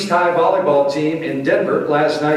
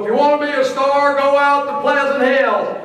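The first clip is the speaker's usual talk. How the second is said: high, quick coach-like bark; Bud Dog impression; 11 semitones higher, 3 dB louder than usual. Speaker mimics coach Bud Dog's old saying, high and quick. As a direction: rattle it off in a high, clipped coach voice. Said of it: "Bud Dog would always go".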